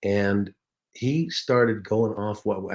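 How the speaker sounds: usual speaking voice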